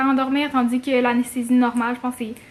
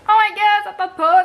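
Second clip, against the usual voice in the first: high, loud panicky outburst; panicked worry; 7 semitones higher, 6 dB louder than usual. Pretend. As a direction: blurt it out in a panic. Acts out a worried patient.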